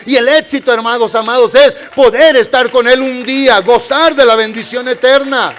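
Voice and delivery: loud, full-voiced sermon build; fervent preaching. Pastor preaching loudly to the brethren about success. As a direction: preach it out to the congregation.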